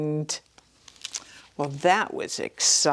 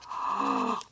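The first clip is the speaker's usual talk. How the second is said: gasp; 5 dB quieter than usual. A narrator's reading. Reader reacts to picture.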